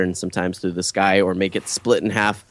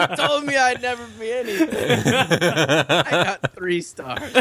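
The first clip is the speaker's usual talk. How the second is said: much higher, laughing mock brag; mock gloating son; 14 semitones higher, slower than usual. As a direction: brag to mom while laughing. Mocking friend's gloating phone call.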